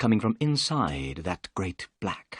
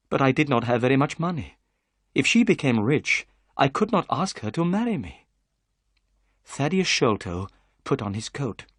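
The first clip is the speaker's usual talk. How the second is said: ordinary delivery, nothing marked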